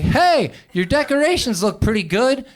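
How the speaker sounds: high, loud chipper neighborly greeting; cheery unbothered dad; breezy mock cheer. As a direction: chirp it casually like nothing happened. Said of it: "He's like".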